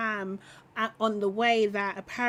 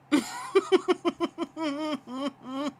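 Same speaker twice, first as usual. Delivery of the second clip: long, rippling chuckle; laughing; 3 semitones higher than usual. Real, not acted. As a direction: laugh it out at length. Laughs at the clip.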